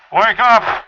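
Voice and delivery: loud, high yell to wake everyone; wake-up shout. Shown as a demonstration. Host yelling at listeners to wake them, loud shout. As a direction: blast it out like an alarm. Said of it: "is to make a lot of noise".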